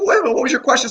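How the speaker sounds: high, quick, startled remembering; sudden recall. Retold or imitated. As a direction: snap back as if it just came to you. Doctor remembers the question.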